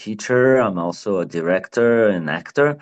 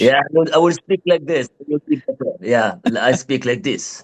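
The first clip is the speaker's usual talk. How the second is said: put-on playfully thick French accent, pitched higher; hammed-up French accent; 6 semitones higher than usual. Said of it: "Just, like, a little more French".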